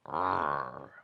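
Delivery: low little-bear growl; cub's pretend fearsome roar; mock growl. Cub growls like papa.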